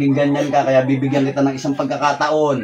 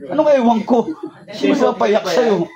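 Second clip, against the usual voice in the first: higher, laughing through the words; laughing tease; 9 semitones higher than usual. Real, not acted. Healer laughing as he teases the crying woman. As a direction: chuckle while you tease her.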